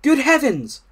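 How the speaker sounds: high, loud exclamation; astonished Frog. A narrator's reading. Frog behind a rock spotting the thing, astonished. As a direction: gasp out the words in surprise.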